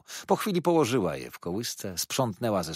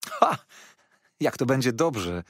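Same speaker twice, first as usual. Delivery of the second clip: excited exclamation; 5 semitones higher, 4 dB louder than usual. Acted. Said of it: "zawołałem".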